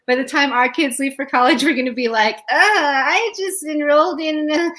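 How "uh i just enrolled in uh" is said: put-on higher, dragging elderly complaint; a touch of weary old age, hammed up; lightly voiced old parent.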